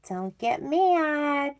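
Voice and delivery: high, slow, soft singsong warning; playful soft plea. Pretend. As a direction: coax them gently, stretched out. Teasing warning before unwelcome news.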